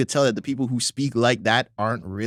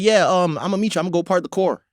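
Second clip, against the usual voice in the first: put-on LA accent; 6 semitones higher, 3 dB louder than usual. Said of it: "your A's are O's".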